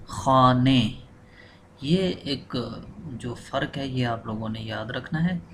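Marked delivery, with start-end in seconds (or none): 0.1-0.8 खाने with final e, louder; Persian ending e. Shown as a demonstration. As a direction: stress the e of खाने. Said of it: "खाना नहीं पढ़ते बल्कि". (खाने, आखिर में ए)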